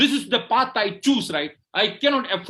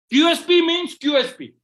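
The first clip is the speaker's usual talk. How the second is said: loud, high rallying cry; rousing shout; 7 semitones higher, 4 dB louder than usual. Real, not acted. Coach firing up the room with a loud shout. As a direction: belt out Q S P to fire up the room.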